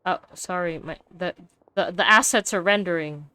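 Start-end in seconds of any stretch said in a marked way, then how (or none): none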